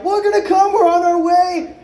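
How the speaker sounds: high, eager fan voice; mock the keen promise, high and sweet. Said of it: "they were all like".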